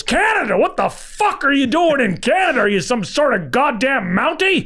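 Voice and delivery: very high, scornful disbelief, slowing at the end; mock the idea of Canada like an outraged old producer; George Schlatter. Producer scoffing at the writer living in Canada, high scornful disbelief. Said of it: "He goes".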